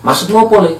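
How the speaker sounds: high, loud challenge from an elder; retold shout. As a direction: raise the voice and demand an explanation. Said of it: "sambil ada teriak gitu".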